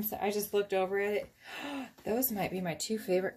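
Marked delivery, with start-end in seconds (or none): 1.5-2.0 quick high, soft gasp; sharp little intake of breath; delighted gasp. Real, not acted. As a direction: gasp in admiration at the colors. Reacts to favorite colors.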